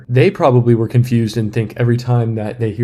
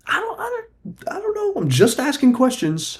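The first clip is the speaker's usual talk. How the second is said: very high falsetto, playing innocent; mock-innocent squeak. Host disowning his own theory, mock-innocent high squeak. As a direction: squeak it up in feigned ignorance.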